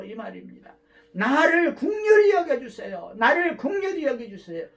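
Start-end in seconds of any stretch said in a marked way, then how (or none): 1.1-4.7 crying out for mercy. Shown as a demonstration. Preacher models crying out.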